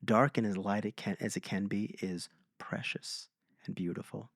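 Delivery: softly unvoiced whisper, savoring each word; hushed knowing voice. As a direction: breathe it like an old monk's calm insight. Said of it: "there's some deeper knowing of".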